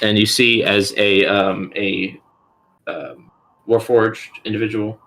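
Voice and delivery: normal talking voice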